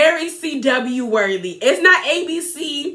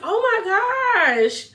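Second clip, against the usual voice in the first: stunned disbelief; 6 semitones higher, much slower than usual. Real, not acted. Reacting in disbelief to scene.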